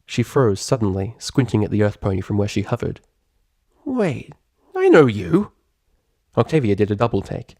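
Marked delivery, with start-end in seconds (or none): 3.8-5.5 sudden recognition. A narrator's reading. Rainbow Dash recognizes her.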